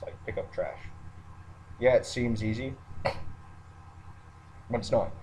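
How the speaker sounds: ordinary delivery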